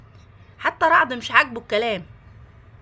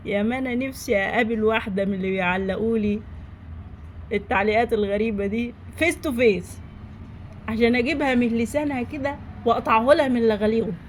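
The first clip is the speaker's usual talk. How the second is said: usual speaking voice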